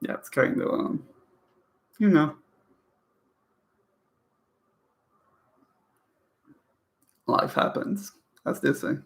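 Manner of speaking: hushed and near tears; getting emotional. Real, not acted. Reader moved by a sad book, going quiet and emotional. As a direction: voice sinking with real feeling. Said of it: "Sorry, I'm getting emotional".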